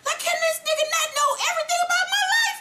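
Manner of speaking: very high, fast, half-crying mock panic; panicked Ghost. Recapper voicing Ghost shocked Milan knows everything, shrill panic. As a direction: shriek it in comic terror. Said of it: "And Ghost is looking like".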